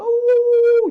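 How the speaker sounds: high, long drawn-out dog whimper howl. Acted. Storyteller howling as the crying dog.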